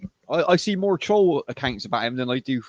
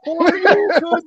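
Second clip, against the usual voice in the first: high, loud battle cry; Street Fighter shout; 14 semitones higher, 6 dB louder, much slower than usual. Pretend. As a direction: yell it like a fighting game move. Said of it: "Ken doing Street Fighter moves".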